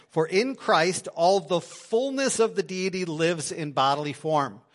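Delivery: preacher reading the passage to the congregation, proclaiming; scripture reading. Acted. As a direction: proclaim the verse slowly. Reads scripture aloud.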